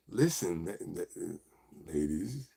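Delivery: very soft, low, halting address; smooth ladies' man. Pretend. Host calling on the ladies, hushed smooth voice. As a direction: sink into a hushed, deep suave voice.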